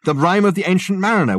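high, loud, eager recognition; excited Richard figure. A narrator's reading. Richard telling Dirk it sounded like Coleridge, excited. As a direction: burst out with the realisation.

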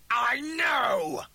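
high, gloating shout; evil glee. A narrator's reading. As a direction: crow it with a wicked grin. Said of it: "the double grinned evilly".

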